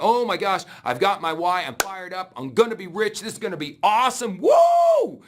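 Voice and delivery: mock pumped-up newbie, ending in a woo; fired-up excitement. Imitating a new recruit dreaming of getting rich, excited shout.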